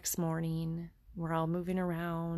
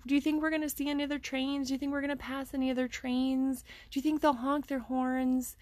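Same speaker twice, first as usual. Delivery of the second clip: put-on much higher, excited child's voice; excited little kid; 8 semitones higher, faster than usual. Son calling out to mama on the train, higher and excited. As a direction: mimic a thrilled little boy. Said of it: "Just being an excited little kid".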